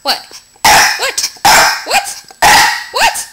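playful dog-hyping, going sky-high; rising excited teasing. Real, not acted. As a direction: climb into a squeaky pitch to hype the dog.

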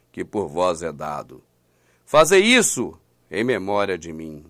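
slow, measured Bible reading with falling, fading phrase ends; solemn scripture reading. Acted. Preacher reads Luke's supper account to listeners, solemn and slow. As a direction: read the verse gravely, dropping low between key phrases.

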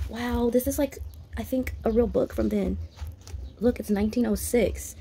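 ordinary talk

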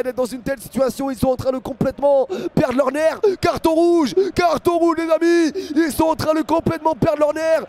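high, loud, urgent football commentary; excited play-by-play. Real, not acted. Commentator telling viewers Charé is sent off, excited and high-pitched. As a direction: build to outrage over the red card. Live commentary of red card.

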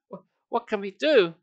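usual speaking voice

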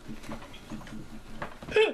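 wheezy high giggle; airy cracking laugh; high breathless laugh. Real, not acted. Laughing at own bit.

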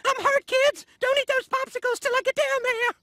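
high, loud frantic alarm; panicked shouting. Acted. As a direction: shriek warnings in a panic. Panicked flight from a pervert.

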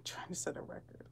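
breathy whispered aside to herself. Real, not acted. Streamer whispering an aside while texting her mom. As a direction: whisper it so nobody hears.

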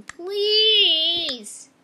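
loud drawn-out pleading please; loud begging. Pretend. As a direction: beg it loud.

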